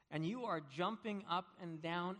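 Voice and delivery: normal talking voice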